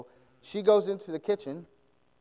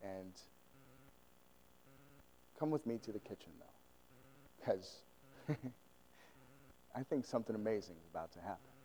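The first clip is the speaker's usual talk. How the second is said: soft, secretive, a touch faster; hushed aside; 7 semitones lower, 16 dB quieter than usual. Retold or imitated. Mother quietly calls her son to the kitchen, hushed voice. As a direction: draw him close and let him in on a secret.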